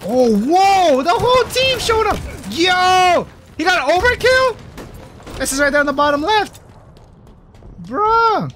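high, loud shouts of astonishment; amazed hype. Real, not acted. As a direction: explode with excitement at the play. Amazed at teammate's overkill.

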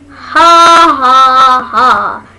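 loud flat mock laugh; sarcastic ha ha. Annoyed at the joke, she mocks it with a fake laugh. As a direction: fake a laugh to show it wasn't funny.

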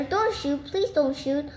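usual speaking voice

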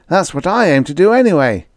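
put-on high, bright-eyed boy showing off his plan; a young boy playing it virtuous; eager schoolboy voice; boyish Red voice. A narrator's reading.

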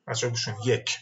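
ordinary delivery

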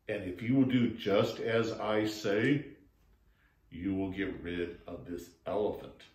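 put-on low, unhurried old toad voice; old toad calmly advising the frogs; wise old toad.

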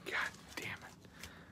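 cursed under the breath, almost silent; whispered curse. Real, not acted. Streamer disappointed by a pull, whispered curse. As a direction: hiss it to yourself.

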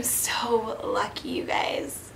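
hushed whispered gush. Retold or imitated. Recalling her thoughts after the proposal, whispering. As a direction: breathe it like a secret.

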